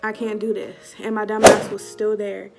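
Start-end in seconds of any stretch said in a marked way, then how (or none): none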